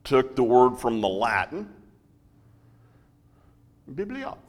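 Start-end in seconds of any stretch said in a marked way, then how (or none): 4.0-4.4 high, soft mock-French lilt; French accent. Shown as a demonstration. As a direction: lift it high and rising, as if French. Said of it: "Does that sound French?".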